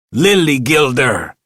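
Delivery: high, loud furious scream. A narrator's reading. Manager screaming at the old janitor, furious. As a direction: scream the insult at the booth. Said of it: "he screamed".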